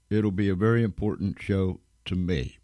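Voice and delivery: usual speaking voice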